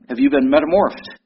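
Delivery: ordinary talk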